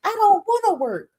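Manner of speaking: high, loud mock wail; Tierra. Vlogger mimicking Tierra announcing a pregnancy, high and loud mockery. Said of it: "Then she wanna be like".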